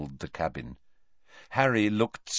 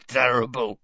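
grunted; 5 semitones higher, 4 dB louder than usual. A narrator's reading. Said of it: "Hagrid grunted".

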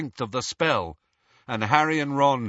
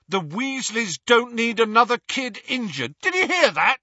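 higher, louder, bitter tirade; angry rant; 8 semitones higher, 4 dB louder than usual. Acted. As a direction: rant at him, hurt and furious. Ron's angry rant at Harry.

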